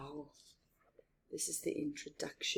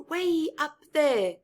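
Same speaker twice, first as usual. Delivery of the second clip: ordinary delivery, nothing marked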